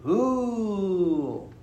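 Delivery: crowd ooh. Retold or imitated. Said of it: "the crowd would go".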